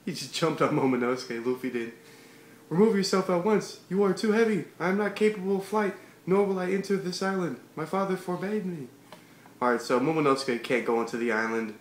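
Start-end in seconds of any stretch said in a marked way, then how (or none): 2.7-8.9 lightly voiced haughty boy, pitched up; a hint of a stiff, proud kid lord; young samurai voice. Reader voicing Momonosuke refusing Luffy, lightly raised haughty voice.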